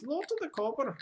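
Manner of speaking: playful half-Welsh voice; mock Welsh accent. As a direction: keep the silly Welsh accent going. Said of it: "something close to Welsh".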